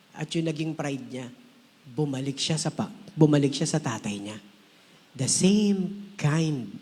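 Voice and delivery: ordinary delivery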